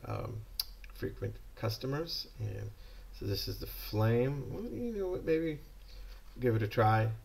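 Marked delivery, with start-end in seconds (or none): none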